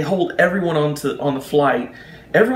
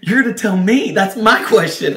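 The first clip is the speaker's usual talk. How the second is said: incredulous retort; 6 semitones higher, 4 dB louder than usual. Retold or imitated. Retort to panicking agent.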